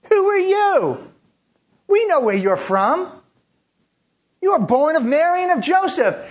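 high, loud, mocking jeers at Jesus; the crowd sneers him down; scornful crowd. Crowd grumbling against Jesus.

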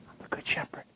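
whispered tenderly. Acted. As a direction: breathe it out like a secret. Intimate whispered quote of Jesus.

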